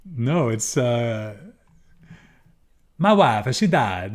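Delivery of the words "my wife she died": a louder mock Borat accent; half-hearted Borat voice; Borat impression. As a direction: mimic Borat's accent with a deadpan line. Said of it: "Is this Borat?".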